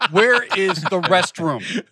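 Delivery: slow, loud, high-pitched mock demand; hammed-up exasperated parent. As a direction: spell it out loudly for someone playing dumb. Acted exasperated parent at Renaissance fair.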